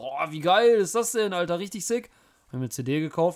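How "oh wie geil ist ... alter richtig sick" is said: excited. Retold or imitated. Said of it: "stand ich davor und war nur so".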